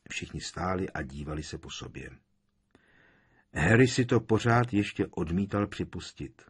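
usual speaking voice

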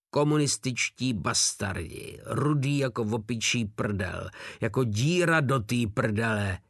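usual speaking voice

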